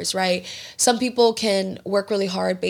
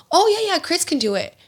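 high-pitched, casual yes on someone's behalf; airy, eager agreement, re-enacted; breezy volunteering; 8 semitones higher than usual. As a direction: imitate yourself offering him up without thinking. Herself breezily volunteering her husband.

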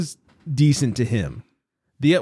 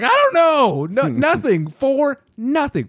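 mock baffled; 12 semitones higher, slower than usual. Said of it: "I was like".

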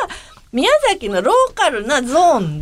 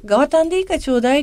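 quick, casual mock plea in Miyazaki dialect, a bit higher; offhand request; 7 semitones higher, much faster than usual. Classmate at the reunion asking for the empty case, fast and offhand. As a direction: toss off ガワタン lightly and fast. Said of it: "そのガワタンだけ".

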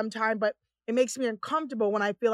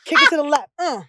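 higher, punchy camp dance commands; imitating a sashaying cousin; flamboyant dance caller; 4 semitones higher, 7 dB louder than usual. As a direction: call out sassy runway moves. Cousin's flamboyant dance routine, retold.

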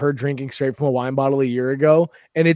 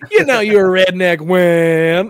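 loud, drawn-out blue-collar comic impression; 5 semitones higher, 9 dB louder, slower than usual. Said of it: "as close to blue collar comedy".

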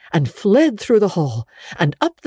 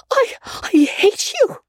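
high, slow, tearful cry of hatred; choked crying. A narrator's reading. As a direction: force it out through sobbing fury. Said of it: "she cried in a choked voice".

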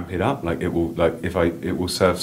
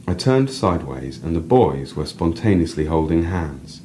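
reading a personal story aloud, lively; 6 semitones higher, 5 dB louder, slower than usual. A narrator's reading. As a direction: read your piece to the listener with feeling. Reads own story aloud.

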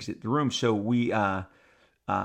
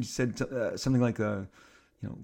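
ordinary delivery, nothing marked

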